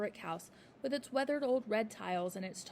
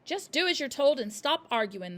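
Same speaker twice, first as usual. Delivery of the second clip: loud irritated snap; snapping; 5 semitones higher, 8 dB louder than usual. A narrator's reading. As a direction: cut the girl off. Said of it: "the father snapped".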